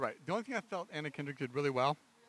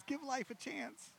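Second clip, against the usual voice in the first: high, soft and slow pleading; high soft plea; 12 semitones higher, 8 dB quieter, slower than usual. Retold or imitated. Mimicking Anna Kendrick's character.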